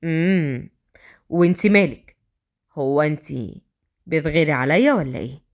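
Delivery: low, unruffled male voice, teasing; Adham, provocatively calm. Acted. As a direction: needle her coolly. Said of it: "أدهم بهدوء مستفز".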